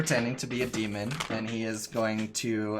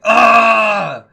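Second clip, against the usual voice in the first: long, loud, high yell of rage; furious in-character roar, hammed-up; angry yell; 12 semitones higher, 20 dB louder than usual. Game master acts out an enraged Greg, angry yell. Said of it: "he's huffing, puffing, he's mad".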